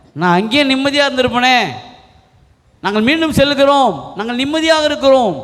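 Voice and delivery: high-pitched, aggrieved complaint; indignant protest. Acted. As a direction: act out the people's reproach to their leader. People angrily reproach Moses, acted.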